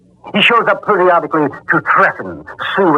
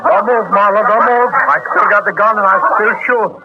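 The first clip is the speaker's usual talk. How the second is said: menacing threat; 8 semitones higher than usual. Acted. Gunman threatens Marlowe after crash.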